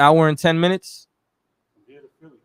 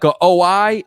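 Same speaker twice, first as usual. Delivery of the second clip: ordinary talk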